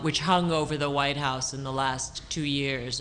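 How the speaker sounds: usual speaking voice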